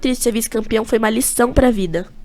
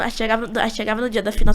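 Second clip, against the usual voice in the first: ordinary talk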